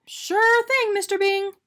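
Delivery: high, loud eager donut. A narrator's reading. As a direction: answer it keenly. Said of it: "Arnie answered".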